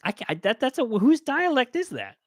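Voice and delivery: high-pitched mock disbelief; incredulous French speakers. French speakers react to an actor's bad French, mock incredulous. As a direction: voice puzzled native speakers, pitched well up. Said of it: "people who speak French are gonna be like".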